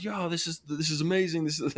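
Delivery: high, stammering thrill; retold excitement. Two hunters celebrating their shots, acted-out excitement.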